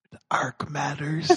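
faint breathy deathbed whisper; mock last words, barely audible; dying whisper. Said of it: "unvoiced whisper".

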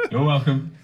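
high laughing after punchline. Real, not acted. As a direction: laugh it out, pleased. Laughing at own joke.

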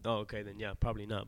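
ordinary talk, nothing marked

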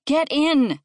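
exasperated mother's command; shouted order. A narrator's reading. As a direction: shout it at squabbling kids. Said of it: "Alice shouted".

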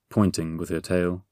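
plain reading voice

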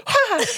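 high, sudden mock gasp; startled gasp. Mom retells the leaf hitting her hand, startled gasp. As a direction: act out a short startled "ha". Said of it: "It scared the shit out of me".

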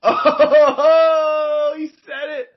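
high, laughing burst. Real, not acted. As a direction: crack up loudly, voice squeaking. Cracking up at joke.

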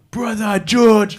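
high, slow, loud call of Brother George; stretched-out hearty hello; excited greeting. Retold or imitated. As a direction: boom out a big warm welcome. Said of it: "Mills was like".

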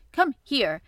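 loud, urgent command; shouted. A narrator's reading. Angry boy orders his friend over, shouting. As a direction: call him over sharply and loudly. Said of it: "he shouted at Dickon".

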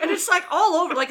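ordinary delivery

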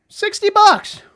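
loud, high-pitched burst; high exclamation. Real, not acted. Host marveling at webcam's price, high loud exclamation.

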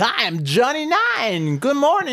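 high, slow, hyped radio announcer. Retold or imitated. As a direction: ham up a cheesy morning DJ. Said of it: "they're like".

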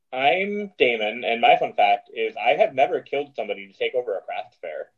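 ordinary delivery

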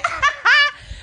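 high-pitched cackle; real laugh. Real, not acted. Laughing at recording the next night, real laugh. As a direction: let out a high burst of laughter.

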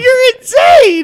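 very high, loud, drawn-out shriek; real high-pitched outburst of astonishment; amazed shout. Real, not acted. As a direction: shout it slowly in delighted disbelief. Real amused outburst at story.

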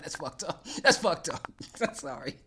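laughing while apologizing; laughing apology. Real, not acted. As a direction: giggle through the sorry. Laughing at own joke.

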